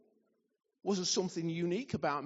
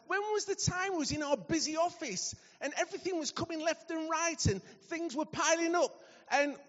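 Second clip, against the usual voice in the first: higher, pressing preacher's questions; building preaching; 8 semitones higher, 3 dB louder than usual. Acted. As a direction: drive the questions home to the church.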